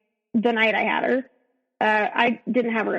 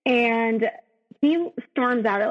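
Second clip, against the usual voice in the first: normal talking voice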